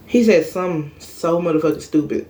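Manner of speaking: ordinary delivery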